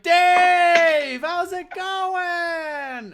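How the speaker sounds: high, loud call across the stall; loud friendly greeting. Acted. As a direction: shout a cheery hello to an old friend. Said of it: "And he's like".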